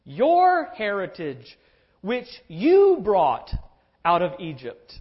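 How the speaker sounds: slow, loud, weighty reading driving at "you"; stress on you. Shown as a demonstration. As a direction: spell out "which you brought out of Egypt" with force on "you". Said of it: "You see the difference?".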